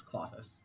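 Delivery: Clothis said "KLAH-thiss", short AH; correct two-syllable pronunciation. Shown as a demonstration. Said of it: "stickler for pronunciation".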